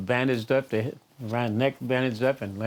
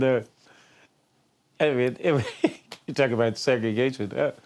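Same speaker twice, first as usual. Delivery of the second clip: ordinary talk, nothing marked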